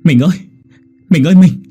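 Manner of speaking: loud, high grieving wail; wailing call. A narrator's reading. Husband wailing over his dying wife, loud and high. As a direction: wail to her to not leave. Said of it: "gọi lớn".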